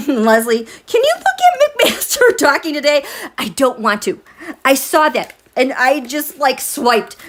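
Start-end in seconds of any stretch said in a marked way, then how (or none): 0.9-3.0 laughing disbelief. Real, not acted. Laughs at McMaster clip.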